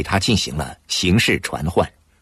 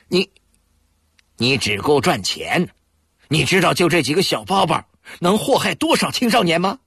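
indignant; 9 semitones higher than usual. A narrator's reading. Alikun confronts the dealer.

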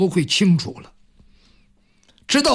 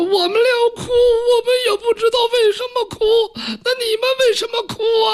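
very high, rapid mock-sobbing; tearful palace maids; faster than usual. A narrator's reading. Maids inside answering those at the door, tearfully bewildered. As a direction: squeal it out through comic tears. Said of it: "他们在那抽抽搭搭的哭".

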